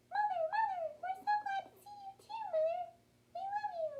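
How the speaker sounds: put-on tiny, very high baby mouse voices; squeaking pups. A narrator's reading. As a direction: squeak a loving welcome to mother. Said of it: "sleeping and squeaking for her arrival".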